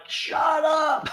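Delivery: high mock yell of "Shut up"; yelling down the stairs at the son; mock shout. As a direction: snap it up high like a fed-up mom. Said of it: "I'm like".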